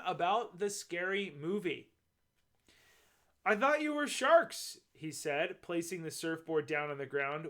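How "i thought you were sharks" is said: much higher voice; relieved child character. A narrator's reading. Pablo explains the surfboard to his parents, high-voiced.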